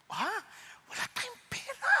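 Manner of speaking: shocked high "Ha?"; startled. Retold or imitated. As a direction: blurt it out in sudden alarm. Startled reply to wife about money.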